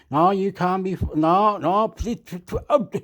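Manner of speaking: high pleading no, no, please. Acted. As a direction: beg the rival to stop, voice rising. Acted quarrel over news.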